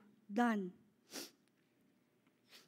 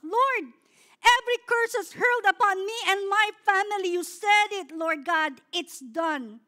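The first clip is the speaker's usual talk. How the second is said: loud confident prayer, louder on curse; bold declaration; 3 semitones higher, 5 dB louder than usual. Pretend. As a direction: proclaim it over your family with authority. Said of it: "Sabi mo sa sarili mo". (malakas at matapang na pagpapahayag sa panalangin)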